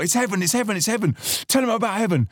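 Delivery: put-on high-pitched Spanish-accented exhortation; imitating Papa Luis. Older friend urging host to tell people about heaven, Spanish accent. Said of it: "he had this thick Spanish accent".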